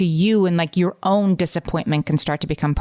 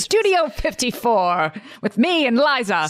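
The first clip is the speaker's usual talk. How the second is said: playful, higher-pitched name-dropping of a glamorous club; mock-glamorous scenester voice; hammed-up club reveler; 7 semitones higher than usual. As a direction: put on a nightlife regular reminiscing.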